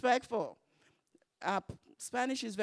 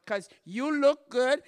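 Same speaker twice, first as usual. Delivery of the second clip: teasing mock accusation; 5 semitones higher, 7 dB louder, slower than usual. Teasing the nurse.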